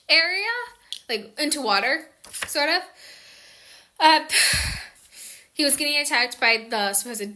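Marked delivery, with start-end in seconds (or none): none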